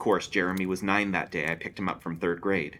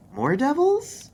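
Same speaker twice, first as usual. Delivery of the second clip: put-on teen girl quip; 5 dB louder, much slower than usual. A narrator's reading. Teen Gwen quips.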